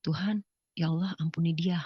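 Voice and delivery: soft, humble plea to God; murmured prayer after an ordeal; low-volume, subdued entreaty; quiet prayer. Retold or imitated. Recalls praying quietly in the garden.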